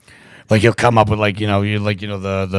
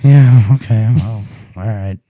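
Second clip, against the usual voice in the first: reluctant dad; 6 dB louder, slower than usual. Retold or imitated.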